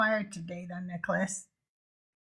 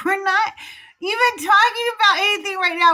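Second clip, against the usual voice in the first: higher, faster exasperated comeback to chat; exasperated retort; 6 semitones higher, faster than usual. Retold or imitated. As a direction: retort to trolls, pitched up and sped. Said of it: "And I'm like".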